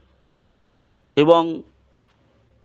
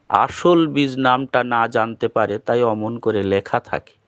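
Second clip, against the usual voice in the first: ordinary talk, nothing marked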